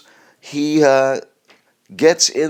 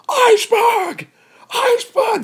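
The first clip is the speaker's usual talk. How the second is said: panicked scream, much higher, drawn out slow; slower than usual. Pretend. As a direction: scream it in sheer terror.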